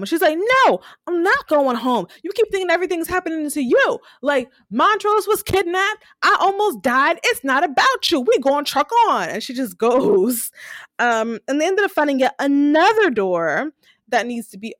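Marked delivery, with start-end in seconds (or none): 0.7-9.3 high, insistent retold argument; acted-out fed-up tirade; indignant refusal. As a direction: argue back heatedly, pitched up. Acts out Letty's angry refusal.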